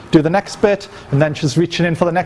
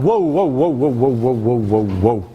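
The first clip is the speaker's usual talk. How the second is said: commanding whoa, falling lower and lower; belligerent interruption; 5 semitones lower than usual. Shown as a demonstration. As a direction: cut her off with a string of whoas. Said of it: "this is belligerence".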